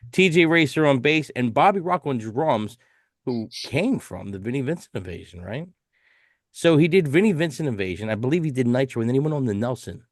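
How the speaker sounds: ordinary delivery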